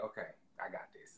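whispered self-steadying; hushed, mimicked composure; imitating holding back tears. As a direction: whisper it to yourself, fighting off tears. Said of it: "you was not trying to cry".

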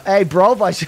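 mock American that stays Cockney, cut short; badly faked American accent for a laugh; joke American accent. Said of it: "what's your American accent sound like?".

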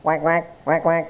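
short quacks in a duck voice; playful quacking duck imitation. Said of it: "Udah, bebek".

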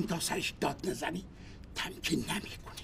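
muttered aside. Acted. Muttering about his wife.

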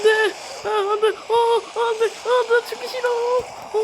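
high frantic wailing; panicked cry. Pretend. About to die in game.

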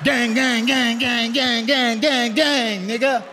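mock the boyfriend's swagger; imitating the boy. Dad mocking daughter's boyfriend, high gang chant. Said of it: "I be looking at him going like this".

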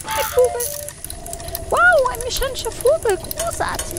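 high, breathless wonder; amazed. Acted. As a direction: gasp at the toy bird. Amazed at a mechanical bird.

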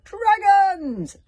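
sudden loud high alarm cry; cried out. A narrator's reading. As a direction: shout the warning in panic. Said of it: "Somebody cried out".

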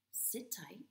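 normal talking voice

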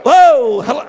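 loud, high burst; exultant shout. Real, not acted. Preacher exulting over being Papa, loud shout. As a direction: shout it out in joy.

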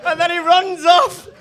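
usual speaking voice

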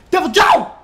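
high, loud yell of a name; frantic shout. Real, not acted. Player yelling for his companion, loud and frantic. Calling his hunting buddy mid-fight.

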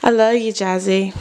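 ordinary talk, nothing marked

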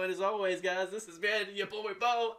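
breathless, winded sign-off; out of breath. Real, not acted. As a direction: push through while winded. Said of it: "I'm out of breath".

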